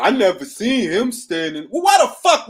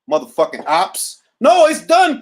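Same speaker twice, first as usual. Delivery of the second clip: ordinary talk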